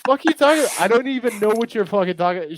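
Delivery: high, indignant retold protest; mock exasperation. Visitor arguing with laser tag girl, high mock exasperation. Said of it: "I'm like".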